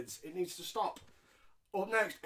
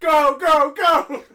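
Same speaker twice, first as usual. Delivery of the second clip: mock cheer; 9 semitones higher, 15 dB louder, much slower than usual.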